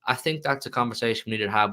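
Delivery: ordinary talk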